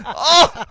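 loud, high "Oh!" of surprise; sharp loud comic gasp of shock; mock startled yelp. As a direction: yelp as if suddenly poked. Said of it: "Is the sound he makes as it goes in".

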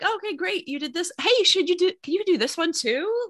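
high-pitched mock cheerful request; imitated eager asker. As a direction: mimic someone breezily piling on favours. Mimics husband piling on requests.

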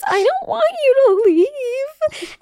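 high, slow, tearful plea; crying mom. Retold or imitated. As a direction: imitate mom crying not to let her go. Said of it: "my mom cries every time I leave home".

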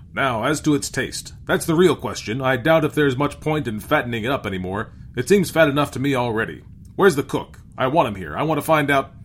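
captain declaring his plan at volume; domineering captain. A narrator's reading. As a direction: take charge forcefully. Voices captain sizing up food.

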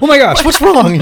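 high, loud startled panic; mock alarmed cry. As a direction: jump to the worst at once. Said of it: "she's like".

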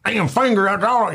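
high put-on voice for Joe; mimicking Joe's indignant excuse; mock Joe protest. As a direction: squeak it out like an indignant tough guy. Joe quoted defending himself.

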